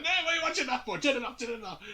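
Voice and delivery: hammed-up panicked dad; imitating a flustered father; mock Michael Jordan. Michael voiced scolding the kids.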